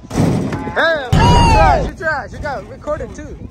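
ordinary delivery

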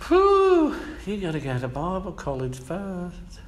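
sarcastic church voice; mock scoff. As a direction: dismiss him like a snob. Mocking church gatekeepers.

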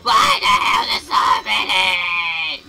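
slow, loud outraged yell; furious gamer outburst; exasperated shout. Real, not acted. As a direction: shout in disbelief at the crowd of enemies. Overwhelmed by enemies.

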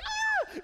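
shrill, very high, loud scream; startled high shriek; high pitched scream. Retold or imitated. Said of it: "if you've ever heard me scream, it's very high pitch".

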